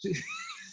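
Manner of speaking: sudden high laugh; high burst of laughter. Real, not acted. Laughs at the motor oil joke.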